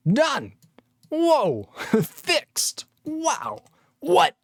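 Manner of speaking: high-pitched, hammed-up wonder; mock amazement. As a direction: squeal in fake astonishment, chuckling between words. Mock amazement at an easy fix.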